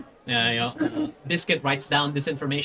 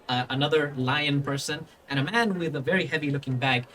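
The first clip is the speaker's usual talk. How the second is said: normal talking voice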